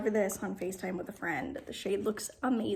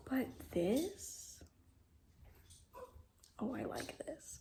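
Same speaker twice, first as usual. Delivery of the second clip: whisper; 9 dB quieter, slower than usual. Real, not acted. Whispered delight.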